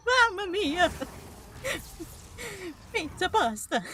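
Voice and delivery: high, hammy Italian chef voice; jolly italian pizza chef. Pretend. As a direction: toss it off like a cartoon chef. Italian bit again.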